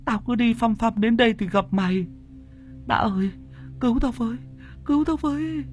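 very high, frantic panic; Tùng telling Đã about the killer doll in terror; terrified. Acted. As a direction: gasp it out like a hunted man. Friend recounts the doll ghost.

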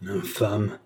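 ordinary delivery, nothing marked

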